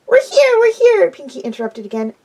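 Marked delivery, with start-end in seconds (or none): 0.1-1.1 much higher and louder, bouncing; excited. A narrator's reading. Pinkie interrupting her friends, excited and squealing. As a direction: squeal it out with glee.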